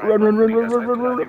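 urgent panicked chant to flee; panicking gamer. Real, not acted. Chased by closing storm bubble.

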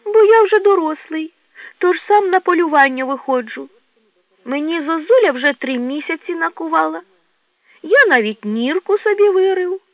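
high childlike badger voice; boastful little badger. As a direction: pipe up proudly, high-pitched. (хвалькувате борсученя, високим голосом)

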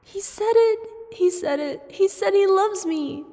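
high, loud giddy cries; overjoyed squeal. Acted. Celebrating that Darkness said he loves her, ecstatic. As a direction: burst with joy.

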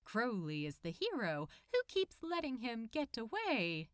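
ordinary delivery

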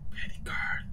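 whispered, voiceless. Real, not acted. In the cold ghost room, telling teammates it's freezing, in a whisper. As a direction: breathe it out under your breath.

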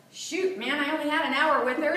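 ordinary talk, nothing marked

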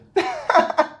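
real high-pitched burst of laughter; laughing. Real, not acted. YouTuber amused at own fake tear, laughing. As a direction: crack up at your own joke.

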